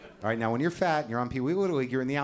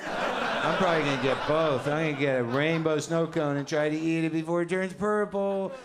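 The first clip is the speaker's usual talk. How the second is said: put-on other kid's reply; 5 semitones higher, 3 dB louder than usual. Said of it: "a little chit-chat with the other guy".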